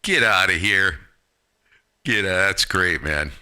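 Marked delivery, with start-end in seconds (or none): none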